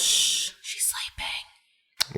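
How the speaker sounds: a slow unvoiced hush; hushed child's whisper at the dark; eerie whisper.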